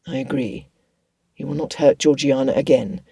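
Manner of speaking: low, restrained gentleman; lightly voiced resolute Darcy. Darcy vowing to his cousin to protect Georgiana, low and resolute.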